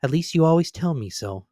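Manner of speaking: high, coaxing little hint; sweetly hinting young wife; hinting niece. A narrator's reading. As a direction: nudge him gently. Niece voiced.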